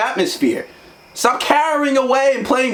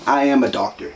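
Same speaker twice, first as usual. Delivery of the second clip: deep confident claim; 11 semitones lower than usual. Retold or imitated. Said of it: "You go".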